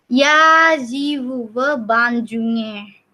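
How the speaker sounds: "Я живу в Бандунге" slowly, word by word; slow example sentence. Shown as a demonstration.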